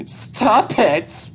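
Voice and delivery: high, loud burst of pleading; desperate shout. Acted. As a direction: shout it out in distress. Child begs dad to stop.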